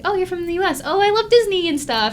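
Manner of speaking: imitated eager maid, voice lifted high; lightly put-on high, gushing voice; high-pitched maid. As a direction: chirp it like a maid making friendly small talk. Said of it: "like, super high pitched".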